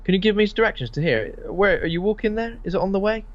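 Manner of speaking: high, coaxing mock driver; creepy kerb-crawler. Killer in a van coaxes a walker, high and sly. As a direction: lure a stranger with a high put-on voice. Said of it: "someone driving around going".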